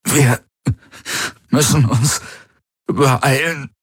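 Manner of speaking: high, strained, halting gasps; cramped, breathless urgency; fighting for words. A narrator's reading. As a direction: fight for every word, pausing between. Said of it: "als würde er um jedes Wort kämpfen".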